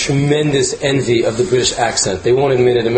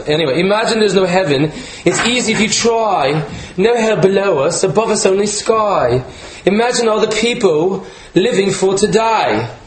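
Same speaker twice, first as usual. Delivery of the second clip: higher-pitched mock British accent reading the lyrics; bad British accent; 6 semitones higher than usual. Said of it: "Please cut, cut the accent".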